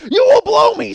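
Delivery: high, loud mock screaming fit; hammed-up furious shout; enraged ranting. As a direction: scream it like a raving maniac. Mel Gibson's rant acted out.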